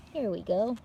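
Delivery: plain reading voice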